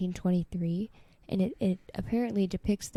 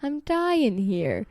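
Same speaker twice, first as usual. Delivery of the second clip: slow, drawn-out whine; slow mock dying; 3 semitones higher, much slower than usual. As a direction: groan it like you're fading. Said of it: "final line".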